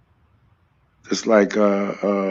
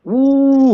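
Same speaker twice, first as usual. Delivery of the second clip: excited ooh; 5 dB louder than usual. Retold or imitated. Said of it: "I was just like".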